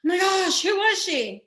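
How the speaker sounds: high, thrilled "who was she?" as an imagined fan; mock amazed gasp. Pretending viewers react at the end, mock amazed. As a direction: play a wowed viewer begging to know. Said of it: "be like".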